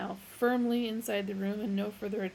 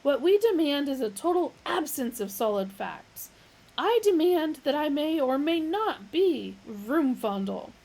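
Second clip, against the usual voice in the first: very loud, higher; ranting philosopher; shouting; 6 semitones higher, 9 dB louder than usual. A narrator's reading. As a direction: shout without pausing. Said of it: "Roomfondle shouted".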